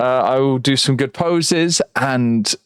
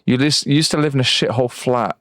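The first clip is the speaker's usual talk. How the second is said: usual speaking voice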